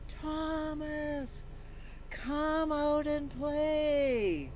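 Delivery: high, slow call from far away; far-off yell. Acted. A friend calls Thomas from far off in the playground, drawn-out. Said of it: "someone yelled".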